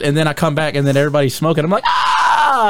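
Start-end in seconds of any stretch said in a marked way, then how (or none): 1.9-2.7 high drawn-out mock scream. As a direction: cry out in exaggerated torment. Acts out craving among smokers.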